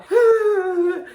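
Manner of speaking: high, drawn-out mock wail; imitated crying. Mocking the boy who sat down crying, imitated wail. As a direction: squeal out an exaggerated fake sob. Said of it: "This man started crying".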